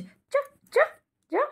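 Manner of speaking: very high, thin calling sound; high squeaky call to coax a pet; squeaky dog call. Real, not acted.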